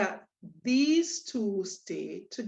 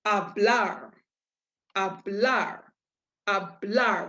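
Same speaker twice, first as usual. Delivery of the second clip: "hablar" with the second syllable, blar, stressed; slower than usual. Shown as a demonstration. Teacher showing how to pronounce the word, "hablar" stressed on blar. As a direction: lean on the final "blar" of "hablar". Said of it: "with an emphasis on that blar".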